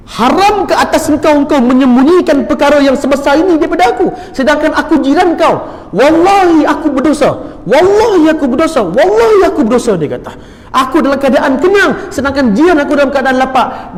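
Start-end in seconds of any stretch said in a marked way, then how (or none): 0.1-10.0 high, loud anguished self-reproach; impassioned preacher voicing a distraught man; acted tearful outcry. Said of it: "Menangis lelaki tadi tu". 10.7-13.9 high, raised-voice remorse; impassioned retold outcry of guilt; acted anguished lament. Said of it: "Menangis lelaki tadi tu".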